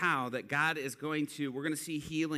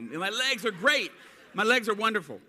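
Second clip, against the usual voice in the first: beam it out like a healed man; ecstatic; 7 semitones higher, 8 dB louder than usual. Retold or imitated. Guy ecstatic after healing.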